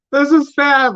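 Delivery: hammed-up burst of being moved; mock teary reaction. Rewatching an old movie, suddenly moved, mock teary. Acts out own reaction to film.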